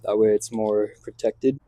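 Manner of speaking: ordinary talk, nothing marked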